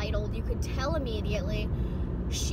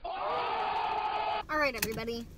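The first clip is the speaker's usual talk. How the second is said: calling out; slower than usual. Real, not acted. Calling to the group arriving.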